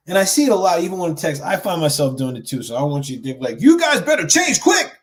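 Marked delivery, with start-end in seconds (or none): 3.6-5.0 high, loud pretend scolding; mock bossy. Coach mocking a preachy tone he doesn't mean, demanding. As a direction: bark an order at the viewers as a joke. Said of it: "that's far from my intention".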